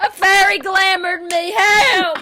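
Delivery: high, loud hammed-up wail; mock cry for help. Pretend victim blaming a fairy, yelling for help. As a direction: yell it like a panicked victim, joking.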